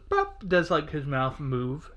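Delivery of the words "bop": high pop. Pretend. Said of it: "the Jack in the Box goes".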